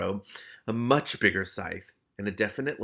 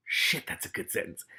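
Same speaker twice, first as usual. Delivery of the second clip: unvoiced whisper of admiration; whispered aside; 5 semitones higher than usual. Real, not acted. Reader praising the sentence, in a whisper. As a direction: murmur it under your breath, impressed.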